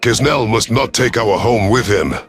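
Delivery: ordinary delivery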